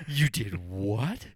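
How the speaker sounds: drawn-out, slowed echo of the question; slow, stunned repeat of the question; slow stunned disbelief. Retold or imitated. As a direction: stretch it out slowly, as if the surprise is sinking in.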